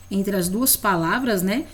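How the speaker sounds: ordinary talk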